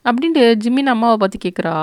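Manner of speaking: usual speaking voice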